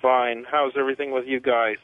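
ordinary talk

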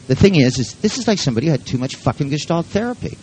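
ordinary talk, nothing marked

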